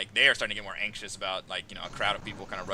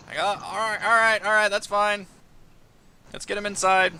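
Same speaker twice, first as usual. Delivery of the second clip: high, loud flustered grumble; a put-upon guard throwing up his hands; exasperated guard; 11 semitones higher, 5 dB louder than usual. Acted. Guard cursing the jungle to the party, high flustered voice.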